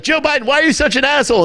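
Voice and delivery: high, fast mock yell from the crowd; heckler shout. Hecklers yelling at Biden, a high, fast mock jeer. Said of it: "they're like".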